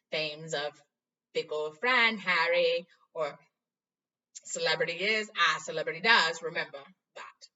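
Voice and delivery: loud, preachy Lockhart; pompous Lockhart. Acted. Vain teacher lecturing Harry on fame, loud. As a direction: lecture about fame, full of himself.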